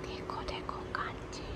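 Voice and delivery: hushed unvoiced whisper. Real, not acted. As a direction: whisper so nobody hears. Whispering so he won't hear.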